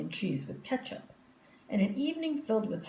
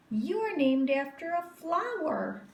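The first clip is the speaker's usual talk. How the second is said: put-on high, superior little girl; smug girl; 8 semitones higher than usual. A narrator's reading. Victoria boasting to the class, high and smug. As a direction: lift your nose and boast.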